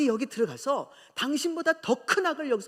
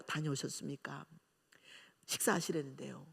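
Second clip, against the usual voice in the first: coldly polite; 7 semitones lower, 9 dB quieter than usual. Retold or imitated. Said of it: "깍듯하게 했어요".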